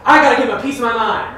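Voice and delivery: loud, fast, huffy imitation of an offended man; mock indignant. Pastor mimicking a churchgoer ready to scold, loud and fast. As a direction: play the one itching to tell someone off.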